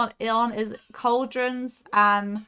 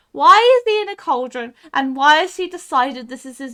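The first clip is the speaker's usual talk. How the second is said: incredulous rant; 3 semitones higher, 9 dB louder than usual. Real, not acted. Ranting about the cauldron man.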